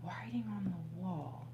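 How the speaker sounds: breathy unvoiced whisper of the line; whispered repeat. Real, not acted. Reader rereading the message to viewers, whispered. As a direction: hush it like a secret omen.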